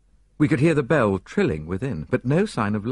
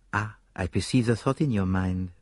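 lightly voiced Poirot. Poirot speaks, smiling.

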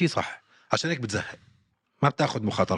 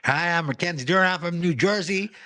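slow, higher New Jersey American accent, imitating the fighter; mock American accent; 7 semitones higher, much slower than usual. Mocking the fighter's switch to an American accent, slow and higher. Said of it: "بتضلها تغير لهجتها بالإنجليزي".

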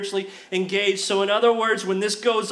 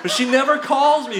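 laughing through the complaint about mom; half laughing, louder; teasing laugh at mom who is watching; 5 dB louder than usual. Real, not acted. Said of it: "laughing about mom".

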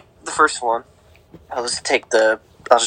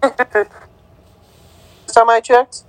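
glitching call audio; 7 semitones higher than usual. Real, not acted. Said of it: "Still couldn't hear ya".

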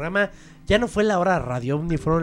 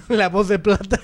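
laughing; 5 semitones higher, 5 dB louder than usual. Real, not acted.

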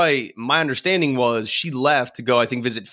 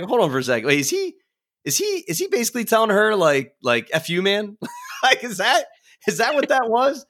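retold disbelief; 8 semitones higher than usual. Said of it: "I remember being like".

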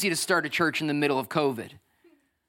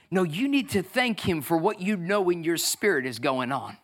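ordinary delivery

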